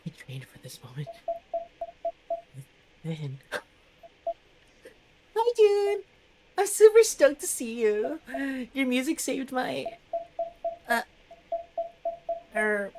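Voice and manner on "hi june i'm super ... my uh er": much higher, louder fangirl squeal; starstruck greeting. Acted. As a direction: burst out at your idol. Nervous fan greets her idol.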